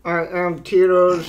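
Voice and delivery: slow put-on robot character voice; halting, cartoonish robot introduction; Tito Servo. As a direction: voice a little robot puppet, slowly. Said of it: "I'll do Tito Servo".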